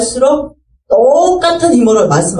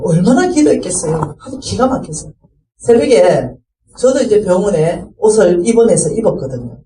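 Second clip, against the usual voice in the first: normal talking voice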